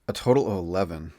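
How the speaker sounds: ordinary delivery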